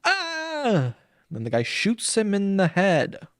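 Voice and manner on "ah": high shrieking monster cry; monster screech. Retold or imitated. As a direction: let out a high creature screech. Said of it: "like, goes".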